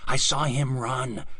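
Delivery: usual speaking voice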